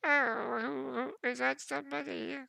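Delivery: put-on high, thin, quavering old dwarf woman; a frail old woman, startled in her room. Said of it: "You hear a frail, old voice".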